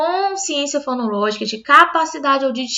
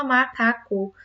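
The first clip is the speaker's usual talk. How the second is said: "macaco" slowly sounded out as ma-ca-co; a child working out the pieces of "macaco"; syllable by syllable; 6 dB quieter, slower than usual. Acted. Teacher voicing a pupil discovering three pieces, slow syllable by syllable. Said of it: "tem três pedaços".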